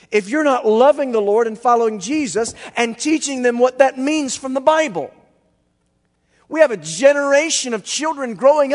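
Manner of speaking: loud, high-pitched sermon delivery; impassioned preaching. Real, not acted. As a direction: preach it out with full force. Exhorts the congregation forcefully.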